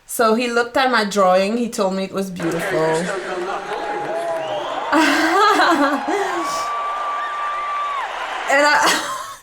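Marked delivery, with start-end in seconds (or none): 3.1-8.4 high, giddy burst of laughter; excited laughing shriek. Real, not acted. Overjoyed memory of meeting Paul.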